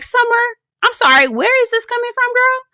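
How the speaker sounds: high-pitched mock bafflement; incredulous. Host questioning where her attitude comes from, mock incredulous. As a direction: call her out in a high, disbelieving voice.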